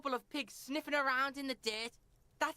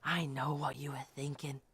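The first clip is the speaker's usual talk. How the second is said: creepy unvoiced whisper; 14 semitones lower than usual. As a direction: whisper it, eerie and close. Kid claims to read mind.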